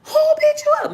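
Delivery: high outraged sister. Retold or imitated.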